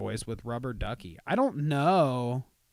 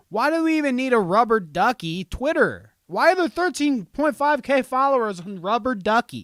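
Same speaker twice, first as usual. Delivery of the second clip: louder and higher, outraged at the follower count; loud indignant rant; 9 semitones higher, 6 dB louder than usual. Real, not acted. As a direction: rant like it's a personal insult. Ranting about Rubber Ducky's followers.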